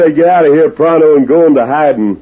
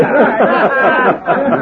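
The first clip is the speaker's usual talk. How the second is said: mocking cackle; 9 semitones higher than usual. Stranger cackles at Happy Morgan's name, mocking laugh. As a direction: cackle to mock the gang leader.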